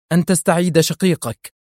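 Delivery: high, defiant retort; stubborn cry. A narrator's reading. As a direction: dig in and throw it back. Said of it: "هتفت في عناد". (هتاف بعناد)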